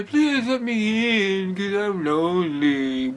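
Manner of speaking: slow, drawn-out whine; whimpering dog. Retold or imitated. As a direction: beg at the door like a whining pup. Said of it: "they whimper".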